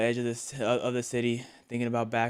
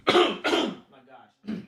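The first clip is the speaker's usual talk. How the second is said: high strained gasp after cough; choked gasp; 11 semitones higher, 4 dB louder than usual. Real, not acted. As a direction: gasp as if choking.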